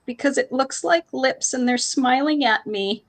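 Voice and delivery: put-on high, slow childish wail rising at the end; a small girl near tears; crying kid voice; upset little girl. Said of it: "She says".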